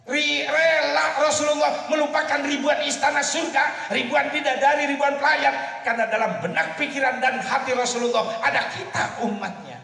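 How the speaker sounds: high, loud, soaring sermon climax; climactic preaching shout. Acted. Preacher telling the congregation of the Prophet's love, shouting. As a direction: lift it to a shouting peak.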